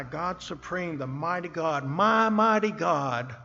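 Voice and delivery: loud, ringing praise to God; bold crying-out prayer. Retold or imitated. David crying out to his creator, loud and strong. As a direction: cry it out with power, not sadness. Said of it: "His prayer could have been something like this".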